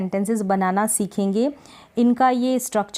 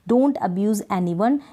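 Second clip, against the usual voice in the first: very slow, each word set apart; slow dictation; much slower than usual. Shown as a demonstration. Teacher dictating an English sentence to learners, very slow. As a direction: spell it out slowly for students. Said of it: "anyone यानी कि किसी को".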